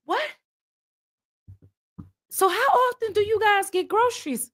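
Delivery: very high baffled question; squeaky, stunned curiosity; incredulous. Retold or imitated. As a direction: ask in comic disbelief. Baffled question to country folk.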